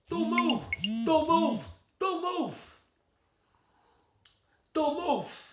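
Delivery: high, loud creepy doll voice; Chucky voice. Pretend. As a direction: squeal it like a killer doll threatening you. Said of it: "you sound like this".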